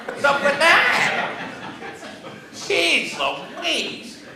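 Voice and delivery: high, slow, laughing complaint; playful exasperation about small print; mock gripe. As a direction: whine it through a laugh.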